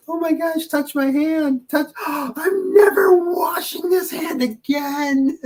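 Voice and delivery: high, squealing starstruck fan; swooning fangirl. Retold or imitated. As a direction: gush like a fan touching a star. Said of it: "they don't need to see you".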